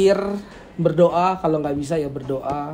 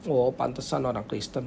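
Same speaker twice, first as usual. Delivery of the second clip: low, softer dismissive remark; knowing disapproval; 3 semitones lower, 6 dB quieter than usual. Shown as a demonstration. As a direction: say it under your voice, as if it figures. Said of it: "Beda ya, nadanya beda ya". (sindiran pelan meremehkan)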